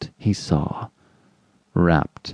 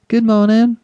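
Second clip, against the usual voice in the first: high, loud, pleasant nurse; pleasant greeting; 14 semitones higher, 5 dB louder than usual. A narrator's reading. Nurse at her desk greets a visitor, pleasantly and high. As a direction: greet sweetly in a high, projected voice. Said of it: "looking up at him pleasantly".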